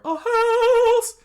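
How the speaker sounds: very high loud shriek; comic high scream. Acted. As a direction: shriek it in horror. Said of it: "Sanji screamed out".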